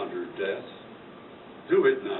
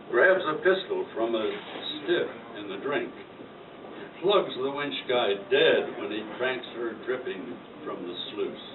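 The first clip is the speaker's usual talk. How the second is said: usual speaking voice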